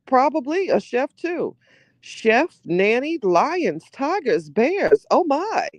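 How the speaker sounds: rising incredulity. Real, not acted. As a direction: climb in pitch with each word. Riffing incredulously on rich lifestyle.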